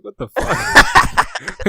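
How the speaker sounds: laughing disbelief, high and loud; stunned laugh out loud; shocked. Retold or imitated. Everyone reacting in shock.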